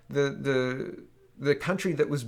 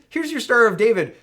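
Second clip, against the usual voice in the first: much higher and louder, biting sarcasm; sarcastic jab; 8 semitones higher, 9 dB louder than usual. Pretend. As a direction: hammer the sarcasm home. Mock address repeated with force.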